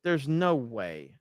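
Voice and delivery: slow, drawn-out, stunned; slow disbelief. Real, not acted. Streamer stares at close poll results, stunned and slow. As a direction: let it sink in slowly, disbelieving.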